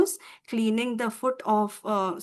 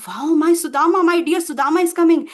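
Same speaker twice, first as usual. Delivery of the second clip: acted delighted cry, higher and louder; excited; 5 semitones higher, 8 dB louder, faster than usual. Krishna running out to greet his dear Sudama, excited. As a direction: exclaim it eagerly, pitch lifted.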